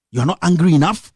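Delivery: ordinary delivery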